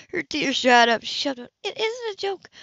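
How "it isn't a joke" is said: upset woman. Acted.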